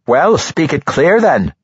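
loud impatient dwarf voice; gruff dwarf woman. A narrator's reading. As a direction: push him to speak up. Dwarf Ambergris urges.

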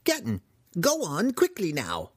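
high, agitated shooing cries. Acted. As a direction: drive them off like stray chickens. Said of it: "as a farmer would to shoo chickens".

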